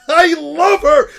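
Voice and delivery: laughing, squealing loud and high, drawn out; ecstatic shout. Real, not acted. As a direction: scream your love for her, slowly. Gushing over Nami.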